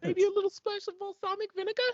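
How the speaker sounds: squeaky muppet ordering a sandwich; Elmo at a bodega. Acted. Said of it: "Elmo ordering at a bodega".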